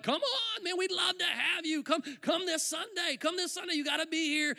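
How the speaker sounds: high, pleading enthusiasm; imitating friends' excited coaxing; eager invitation. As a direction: urge a friend to come along. Friends eagerly inviting him, retold.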